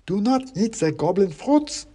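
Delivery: high wooden clack, not hushed at all; failed whisper. Acted. Klaus tries to whisper a warning but clacks. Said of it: "trying to whisper".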